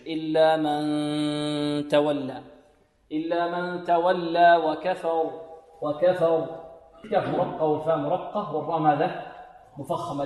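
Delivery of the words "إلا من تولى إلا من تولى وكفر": slow chanted إلا من تولى with ghunnah from the nose; nasal ghunnah. Shown as a demonstration. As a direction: sing it through the nose. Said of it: "من الخيشوم". (غنة من الخيشوم)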